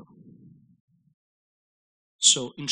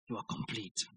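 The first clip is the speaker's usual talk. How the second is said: hushed whisper; 7 semitones lower, 18 dB quieter than usual. Acted. Preacher repeating in hush.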